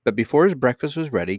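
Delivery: usual speaking voice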